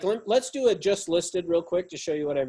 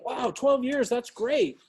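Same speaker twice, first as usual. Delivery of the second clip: higher, wowed affirmation; impressed enthusiasm; 6 semitones higher, slower than usual. Shown as a demonstration. Coach showing how to answer when they say twelve years, excited. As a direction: make the answer sound great news. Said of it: "you gotta be like".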